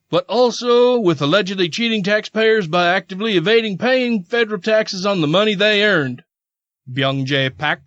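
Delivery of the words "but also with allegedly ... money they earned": higher-pitched mock Southern accent; put-on Southern accent. Host quoting the attorney's statement, put-on Southern accent. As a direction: read it as a Southern official. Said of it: "That was a perfect accent".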